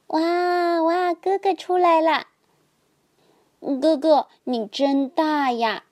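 high, bright cries of joy; excited shouting. A narrator's reading. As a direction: squeal it out, thrilled to see big brother. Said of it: "弟弟们兴奋地叫着".

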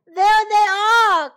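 high, loud, drawn-out shout; crying out. A narrator's reading. Animals show Millie and Molly the men, crying out. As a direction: point them out with a big slow holler. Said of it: "cried the animals".

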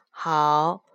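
plain reading voice

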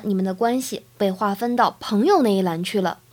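usual speaking voice

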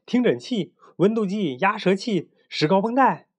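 high-pitched, eager listing of items; eager little helper. A narrator's reading. Little brother offers the doctor tools, eager. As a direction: call out each tool like a keen assistant. Child assistant lists medical tools.

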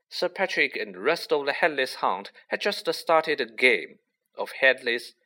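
ordinary delivery

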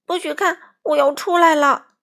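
put-on high, loud childlike shout. A narrator's reading. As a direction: shout a warning to stay away. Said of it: "他大叫道". (高声嚷着警告)

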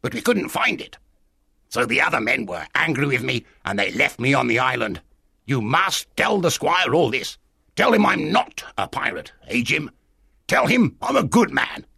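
high, insistent castaway voice; strange castaway. Acted. Castaway wanting the squire told he is good, strange insistent voice.

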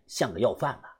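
ordinary delivery, nothing marked

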